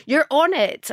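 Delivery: acted excited exclamation; excited neighbour. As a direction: imitate a neighbour bursting with news.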